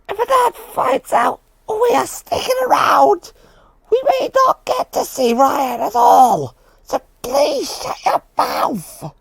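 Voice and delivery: high, tearful pleading that rises to a shout; upset pleading. Acted. Scared of being caught, pleading tearfully with Foxy. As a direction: beg them to shut up, close to tears. Whispered quarrel to be quiet.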